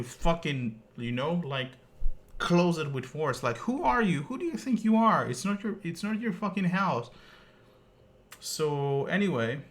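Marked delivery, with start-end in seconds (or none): 3.6-7.1 much higher, rising outraged questions; outraged challenge to the rude delivery man; indignant outrage. Real, not acted. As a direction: scold him as if he stood there. Outraged rhetorical rebuke.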